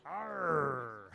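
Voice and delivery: quiet pirate "Arr"; pirate growl. Pretend. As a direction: give a low pirate "Arr". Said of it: "can you guys talk like a pirate?".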